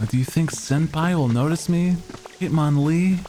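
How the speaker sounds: squeaky tsundere anime schoolgirl; anime girl voice. Pretend. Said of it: "super high-pitched anime girl voice".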